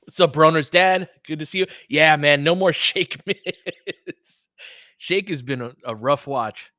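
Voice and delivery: ordinary talk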